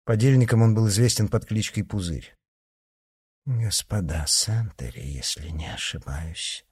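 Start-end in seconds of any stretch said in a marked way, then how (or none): 3.4-6.6 low, hushed and muffled. A narrator's reading. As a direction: say it dully under the breath. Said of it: "глухо произнесла".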